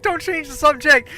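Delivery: high, loud imitation of exasperation; mock scolding. Friend stopping her changing topic, loud mock scolding.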